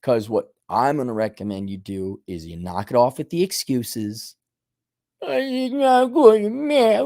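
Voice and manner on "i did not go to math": high, slow, slurred whining; mock whiner. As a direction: whine the excuse like a big baby. Mimicking the excuse.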